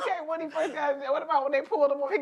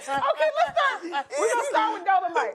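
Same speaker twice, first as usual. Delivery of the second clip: high laughing giggle; barely holding in laughter; 6 semitones higher than usual. Real, not acted. Laughing through setup.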